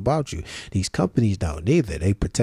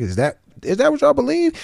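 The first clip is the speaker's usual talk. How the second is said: high-pitched disbelief; incredulous question; 3 dB louder than usual. Real, not acted. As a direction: ask it high, as if it can't be true.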